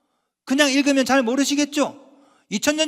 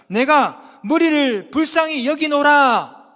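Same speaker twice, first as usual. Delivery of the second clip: loud, slow, raised declamation; scripture proclamation; 4 semitones higher, 7 dB louder, much slower than usual. Acted. Preacher quoting Jesus to the congregation, proclaimed loudly. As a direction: proclaim the verse slowly and forcefully.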